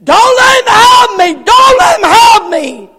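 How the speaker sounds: high, very loud scream of terror; dying man's panicked shriek; terrified screaming. Retold or imitated. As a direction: scream for rescue at full force. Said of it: "he could hear the young man screaming".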